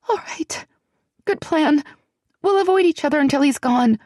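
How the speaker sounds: put-on high, hushed and anxious young woman; a nervous friend fretting in a half whisper; frightened young woman. A narrator's reading. Celianne recovering.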